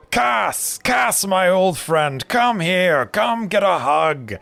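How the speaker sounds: high, loud, jovial brewer voice; hearty old brewer. Acted. Brewer greeting his long-lost friend, high hearty welcome. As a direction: throw your arms wide and boom a welcome.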